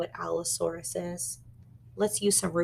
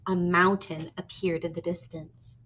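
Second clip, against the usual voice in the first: ordinary delivery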